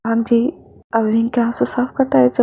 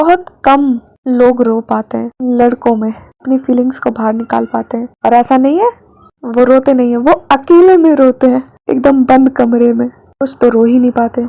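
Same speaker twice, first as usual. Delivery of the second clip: louder, earnest aside to the listeners; heartfelt aside; 5 dB louder than usual. Acted. Narrator stepping out of the story to share the pain of holding back tears. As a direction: speak straight to the audience with feeling.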